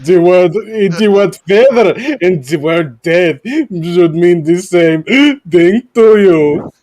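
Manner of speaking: slow, drawn-out mock British Livesey accent; hammed-up British doctor accent quoting a meme. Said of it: "you didn't read into the accent".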